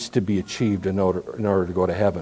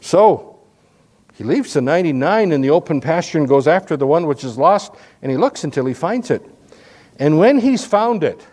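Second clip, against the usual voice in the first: ordinary talk, nothing marked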